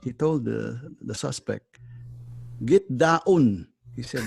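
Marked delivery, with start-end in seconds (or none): 2.6-3.7 firm command in Filipino English; Filipino accent. Retold or imitated. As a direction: order it in a Tagalog accent. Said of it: "There is no daon".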